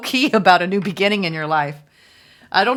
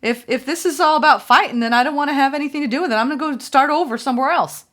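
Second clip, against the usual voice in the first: higher-pitched, tired of the fighting; a quoted voice, higher, worn out with conflict; weary and fed up; 9 semitones higher, 3 dB louder, faster than usual. Retold or imitated. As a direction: voice the one who is done fighting, pitched higher. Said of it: "I even hear that".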